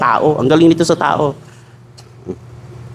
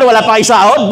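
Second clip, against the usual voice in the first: normal talking voice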